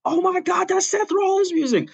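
high, loud commentator shriek on the big surprise; a wrestling announcer losing it at the music hitting; shocked commentator. Retold or imitated. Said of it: "you hear, like".

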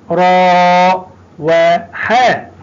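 slow, with راء and حاء stretched long; drawn-out demo. Shown as a demonstration. Teacher showing pupils the long vowel, slowly. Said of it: "ونعلم أن الألف".

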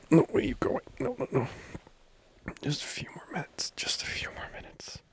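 high, airy pleading; pleading to stay. Pretend. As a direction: beg a partner not to leave. Pleading partner to stay in bed.

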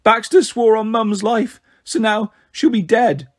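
high, drawn-out acted sobbing; gasping between sobs. A narrator's reading. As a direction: voice a little girl struggling to speak through sobs. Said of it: "gasped between sobs".

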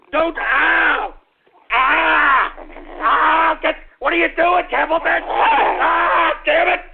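real yelps of pain; yelping ow and ah while bitten; pained yells. Real, not acted. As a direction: cry out as the dog bites. Dog bites him, yelling.

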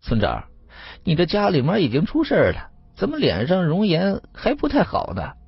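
high-pitched, sly village idler; put-on wonder, voice swooping high; feigned concern. A narrator's reading. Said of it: "故作惊讶的看了村长的脸， 说".

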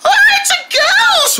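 very high, slow drawn-out squeal; squeaky shriek. Acted. Dog repeating the ghost alarm, very high and slow.

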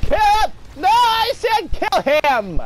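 high, loud, insistent command; yelling orders. Real, not acted. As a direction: bark the order again, drawn out.

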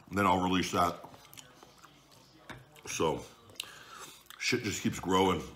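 talking while chewing; eating at the table; mouth full of pasta. Real, not acted. Seen 4: Forkful eaten at -1; he talks through chewing, fork in hand.

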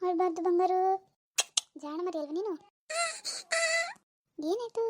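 put-on soft, tender baby-talk; gentle, lilting comfort; cooing to a baby. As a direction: soothe your little darling quietly.

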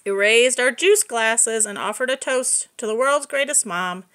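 usual speaking voice

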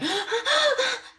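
a big put-on gasp of surprise; mock shocked gasp. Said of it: "And you'll go".

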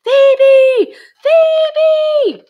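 put-on high, loud yelling child's call; child's scream. A narrator's reading. Small boy on his uncle's shoulders screaming for Phoebe, loud and high. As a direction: scream her name high and loud across the crowd. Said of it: "Enzo took a huge breath and screamed".

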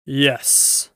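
loud, commanding lion; great voice. A narrator's reading. Great voice behind the girls' backs, loud and grand. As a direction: announce it in a great, full voice. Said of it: "said a great voice".

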